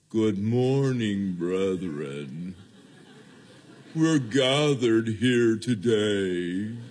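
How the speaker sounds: slow, low, drawn-out mock-solemn preacher; somber minister voice. Minister greeting the brethren under a dark cloud, mock somber. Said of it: "even a somber voice".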